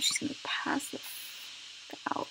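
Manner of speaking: whisper. Real, not acted. Tired aside.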